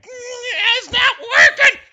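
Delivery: put-on high, loud whiny kid wail; frustrated kid. Imitating a frustrated kid at the wand window, high wailing yell. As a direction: mimic a kid yelling at a wand that won't work.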